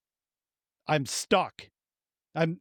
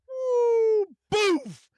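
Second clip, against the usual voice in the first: high yelp then a thud noise; wife hears a high woo and a boof as he falls; falling yelp; 4 dB louder than usual. Retold or imitated. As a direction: squeal as the ankle gives, then flop. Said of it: "And I go".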